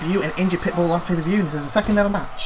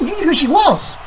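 ordinary talk

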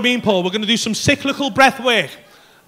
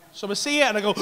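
usual speaking voice

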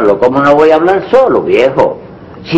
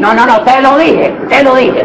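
defiant; 8 semitones higher than usual. Acted. Husband defying wife.

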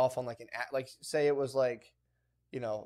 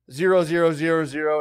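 loud, higher, spelling out each zero; mock shout; 3 semitones higher, 11 dB louder than usual. Wife shouting zeros at a scam telemarketer, loud. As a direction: belt the zeros like a fake card number. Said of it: "And she was like".

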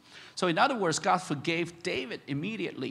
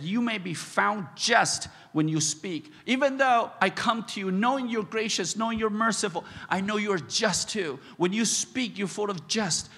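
rising, higher and louder pleading; fervent prayer; 4 semitones higher, 4 dB louder than usual. Acted. David praying to God for mercy, fervent. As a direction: pray it with building fervour.

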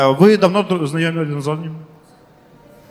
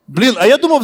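ordinary talk, nothing marked

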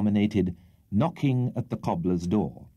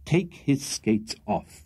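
very slow, firm command; 2 semitones higher, much slower than usual. Acted. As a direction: repeat the order firmly. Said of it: "repeated Mister Pickwick firmly".

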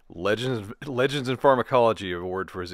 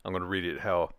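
ordinary talk, nothing marked

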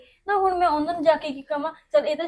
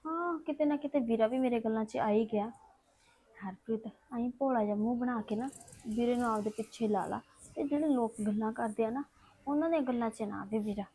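private scheming aside; 8 dB quieter than usual. Acted. Harpreet alone, plotting how to win over brother, quiet aside. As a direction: keep it hushed, as thoughts spoken alone.

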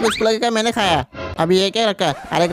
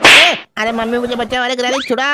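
loud yelp, then higher pleading; panicked cry for help; 6 semitones higher, 5 dB louder than usual. Acted. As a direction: call out to be rescued. Grandpa trapped, calls for rescue.

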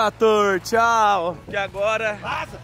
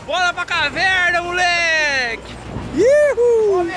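hype friend cheering; 7 semitones higher, much slower than usual. Real, not acted. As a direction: cheer the crew on like a hype man. Hyping the group to go.

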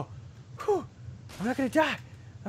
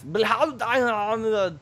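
German reading; 7 semitones higher, 6 dB louder, slower than usual. Pretend.

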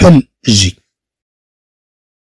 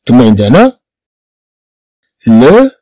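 ordinary delivery

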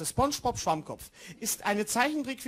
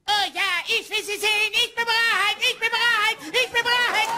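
high, loud cartoon sponge voice; squeaky excited SpongeBob; SpongeBob impression; 9 semitones higher, 5 dB louder than usual. SpongeBob eagerly answers Patrick, high cartoon voice. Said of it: "Ich kann nur den Sprecher gut imitieren".

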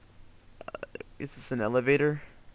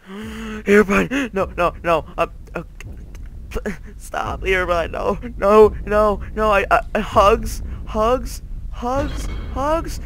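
gasping, high-pitched frantic pleading; panicked; 8 semitones higher, 4 dB louder than usual. Real, not acted. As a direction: stammer and plead, climbing ever higher. Panics on meeting Herobrine.

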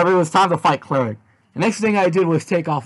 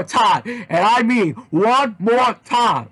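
slow, louder, every word spaced out; menacing slow emphasis; slow threat; 6 semitones higher, 4 dB louder, much slower than usual. Acted. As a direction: drag it out as a warning. Threatening to karate kick them.

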